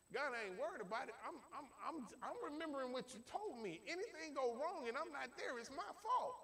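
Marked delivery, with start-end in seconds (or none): none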